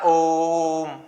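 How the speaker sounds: ओम with a long, held "o"; drawn-out pluta. Shown as a demonstration. Teacher showing students how pluta lengthens Om, drawn-out pluta. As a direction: stretch ओम out steadily before closing on the m. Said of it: "खींच कर बोलने के लिए".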